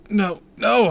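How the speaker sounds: loud, high panicky protest; frantic denial. A narrator's reading. Kid denying he is a spy, panicked. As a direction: blurt it out in alarm.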